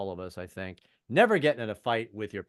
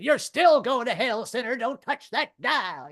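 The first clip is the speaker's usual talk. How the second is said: high, loud hellfire preaching; radio minister yelling at listeners; yelling preacher; 12 semitones higher, 6 dB louder, slower than usual. Retold or imitated. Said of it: "minister yelling".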